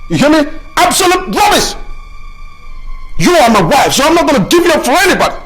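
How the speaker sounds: furious shout. Acted. Husband shouting at wife who wants to leave him, furious. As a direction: shout it at her, high and loud.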